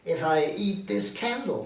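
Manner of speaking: nervous Scrooge. Acted.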